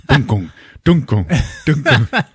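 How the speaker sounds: hollow rhythmic thumps; mouth-made double beats; imitated heartbeat. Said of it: "It's going like".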